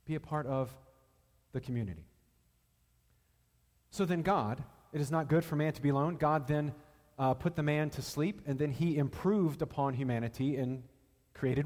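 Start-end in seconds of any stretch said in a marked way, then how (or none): 4.9-6.2 rattled off fast, in passing; tossed off as a hurried reminder; quick recap. Acted. Preacher rushing past the familiar line to his listeners, fast.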